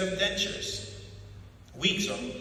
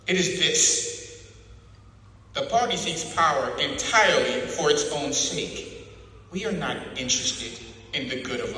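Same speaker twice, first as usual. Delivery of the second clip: cold interrogator building to louder declarations; a torturer laying out the party's creed, rising; O'Brien lecturing; 4 semitones higher, 7 dB louder than usual. Acted. Interrogator to his prisoner, rising cold lecture.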